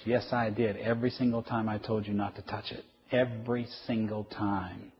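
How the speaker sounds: quiet, emphatic insistence; restrained exasperation. Acted. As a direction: answer softly and firmly, slowing at the end. Parent answers the daughter's denial.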